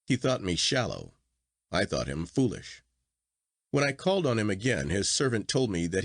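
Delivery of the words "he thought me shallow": ordinary delivery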